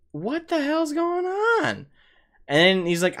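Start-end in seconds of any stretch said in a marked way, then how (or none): 0.1-1.9 high, slow, alarmed cry; shocked at a friend in pain. Retold or imitated.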